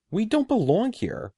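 high, urgent protest; crying out. A narrator's reading. Eddie protesting to the sorcerer, high urgent cry. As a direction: cry out that you don't belong. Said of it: "Eddie cried".

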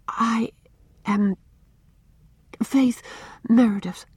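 frightened, with long gaps between words; near whisper. A narrator's reading. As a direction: get it out word by word, timidly. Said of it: "in little more than a whisper".

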